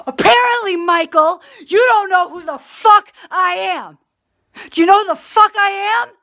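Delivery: gasp, then high, loud outrage; acted outburst. Starting off her mock tirade at Michael, outraged yelling. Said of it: "like to start it off this way".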